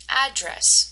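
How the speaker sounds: "Address" with a strong AD; first-syllable stress. Shown as a demonstration. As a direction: stress the start, AD-dress, in "Address". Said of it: "If you said the first part".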